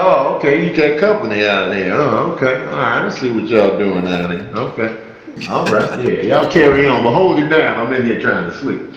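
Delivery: low, understated unimpressed cat voice; casually dismissive cat, pitched a bit down; aloof cat. Acted. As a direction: look the guest up and down, lightly voiced. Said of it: "The cat walks through there quietly".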